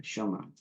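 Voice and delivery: soft repeat of "쇼모랑" as one unbroken word; "쇼모랑" said softly with short o and no pause; short o, joined. Shown as a demonstration. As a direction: run "쇼" straight into "모랑", quietly. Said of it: "그러면 쇼모랑 이렇게 됩니다".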